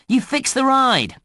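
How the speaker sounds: high, celebratory cheer; excited praise. Acted. Game voice praises player's success.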